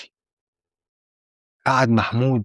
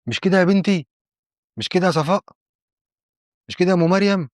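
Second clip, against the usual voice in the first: higher-pitched demand for confirmation; acted indignant appeal, pitched up; insistent appeal; 7 semitones higher than usual. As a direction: press each witness to back you up. Appealing to women as witnesses.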